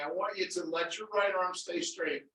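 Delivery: ordinary talk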